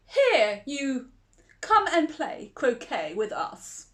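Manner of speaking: a bossy call, loud on the first word; the Queen summons Alice in a mild bossy shout. A narrator's reading. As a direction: bark the order across the lawn. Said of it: "the Queen shouted at, to her".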